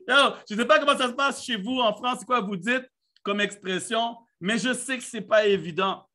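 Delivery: usual speaking voice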